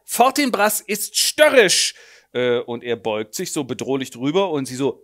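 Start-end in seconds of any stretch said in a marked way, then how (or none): 0.1-1.9 loud, slow, high grand declaration; a vain father declaiming about his baby; pompous actor. Acted. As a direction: pronounce it like a stage verdict. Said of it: "stellt er fest".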